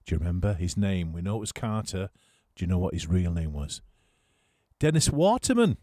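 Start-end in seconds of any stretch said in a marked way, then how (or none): 4.8-5.8 announced high and loud like a quiz answer; triumphant reveal. Acted. DJ revealing the quiz answer to listeners, triumphant. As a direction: call out the answer with a flourish.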